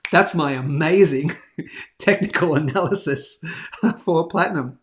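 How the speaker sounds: amused, self-mocking laugh. Real, not acted. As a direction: chuckle at your own grand claim. Laughing at own analysis.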